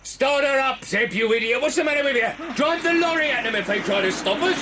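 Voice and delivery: high, urgent barked orders; angry orders. Acted. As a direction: panic turning into fury at the driver. Boss yells at fumbling driver.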